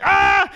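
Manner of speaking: high, loud "ah!" of alarm; sudden high-pitched scream; panicked yell. Retold or imitated. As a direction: cry out as if watching a friend fall. Retold panic at friend falling out.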